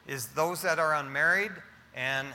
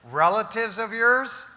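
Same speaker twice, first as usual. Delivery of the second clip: sarcastic; 4 semitones higher, 5 dB louder than usual. Retold or imitated. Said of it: "the husband asked sarcastically".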